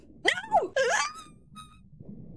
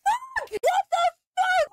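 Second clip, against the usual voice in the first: high, drawn-out disbelief; shocked outcry; 4 dB louder than usual. Real, not acted. Streamer ambushed in game, shocked high outcry. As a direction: cry out, caught off guard.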